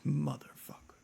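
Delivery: breathy whispered swear; whispered curse. Retold or imitated. Streamer whispering a curse at his girlfriend. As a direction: hiss it under your breath. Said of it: "I'm like".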